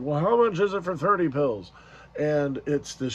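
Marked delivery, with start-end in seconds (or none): 0.0-1.7 quoted man's voice, higher and louder, pressing on much; a stranger at the pharmacy counter pushing for a price; customer asking a price. Retold or imitated. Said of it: "this man is like".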